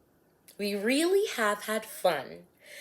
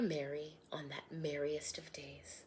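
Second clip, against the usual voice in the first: whisper; 4 semitones lower, 11 dB quieter than usual. A narrator's reading. Rhymed story ending.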